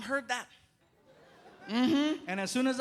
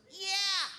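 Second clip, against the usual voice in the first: put-on kid voice; 10 semitones higher than usual. Son voice.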